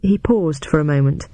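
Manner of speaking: usual speaking voice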